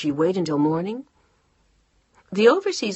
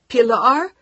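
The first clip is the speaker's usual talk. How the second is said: higher, louder frantic shout; shouting into phone; 7 semitones higher, 10 dB louder than usual. Acted. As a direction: shout the name in panic. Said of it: "She was shouting into the phone".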